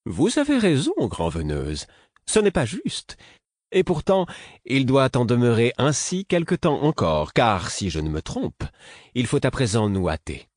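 high, haughty aristocratic voice. A narrator's reading. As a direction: put on your finest noble airs. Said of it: "prit sa plus belle voix d'aristocrate".

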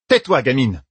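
curt order. Acted. Yukiri silencing Egwene, curt and sharp. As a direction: cut the girl off. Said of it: "lança Yukiri".

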